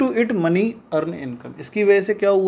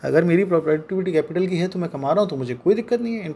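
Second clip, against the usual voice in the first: usual speaking voice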